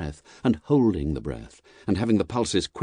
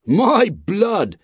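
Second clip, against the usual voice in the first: high, loud coachman's outburst; vexed exclamation; 13 semitones higher, 6 dB louder than usual. A narrator's reading. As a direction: burst out in vexation. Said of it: "ejaculated the vexed coachman".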